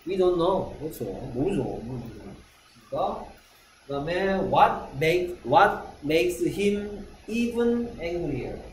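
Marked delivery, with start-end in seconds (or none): 4.5-8.6 what makes him even angrier? said slowly, stress on even; slow model. Shown as a demonstration. Teacher modelling the question slowly for the class. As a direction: spell it out slowly for learners. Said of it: "teacher models slowly".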